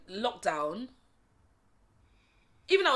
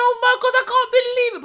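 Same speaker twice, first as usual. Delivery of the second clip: imitating bawling; 12 dB louder than usual. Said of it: "I started bawling".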